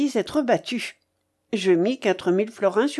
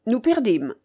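ordinary delivery, nothing marked

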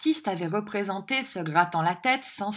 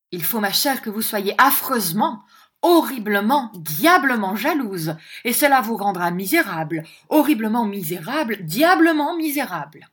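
mock-dramatic, building to higher and louder; teasing dandy husband; 4 semitones higher, 7 dB louder than usual. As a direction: pile on each adverb, growing. Mantalini teases his jealous wife.

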